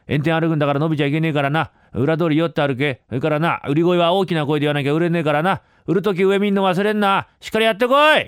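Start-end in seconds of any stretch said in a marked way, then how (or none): 3.7-8.2 raised, higher voice as the uncle giving orders; uncle's animated parting instructions; urging send-off. Acted. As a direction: send him off with a rising, emphatic push. Uncle urging nephew off to sell.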